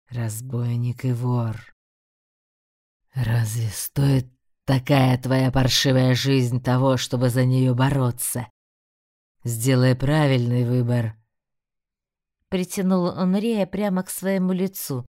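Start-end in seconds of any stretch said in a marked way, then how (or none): 0.1-11.2 low, slow tempting demon; a demon urging a choice. A narrator's reading. Demon taunts Rey. (низкий голос демона-искусителя)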